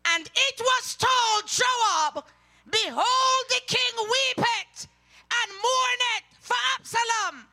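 high, loud, slow scripture shout; preacher's rising cry; fiery preaching. Acted. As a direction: thunder the verse out to the church. Preaching scripture at full force.

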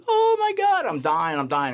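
retold hysterical cry of terror; hysterical panic. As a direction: shriek it like a woman who thinks she is dying. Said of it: "she's hysterical".

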